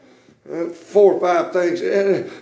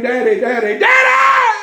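last Daddy leaps much higher and louder; daughter screaming; 4 semitones higher, 5 dB louder than usual. Retold or imitated. Preacher voicing his little girl screaming for him, shrill. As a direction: scream for Daddy through the window. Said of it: "screaming my name".

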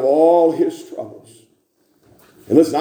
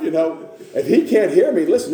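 ordinary talk

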